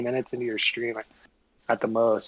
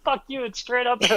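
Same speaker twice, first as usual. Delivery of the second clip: laughing; 11 semitones higher than usual. Real, not acted. Laughing at the friend's comment.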